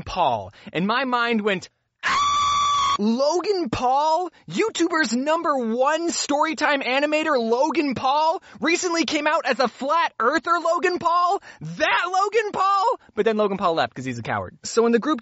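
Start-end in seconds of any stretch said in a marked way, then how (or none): 2.0-3.0 high shriek. Real, not acted. Mind screams at news. 3.0-13.0 high, slow, stunned repeat of Logan Paul; shocked disbelief. Pretend. Shocked mind voice.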